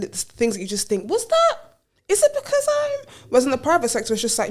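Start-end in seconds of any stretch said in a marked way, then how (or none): 1.1-3.0 squeaky high disbelief; high incredulous inner voice. Retold or imitated. Colleague's inner thought, high and bewildered. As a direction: pitch it way up, baffled thinking aloud.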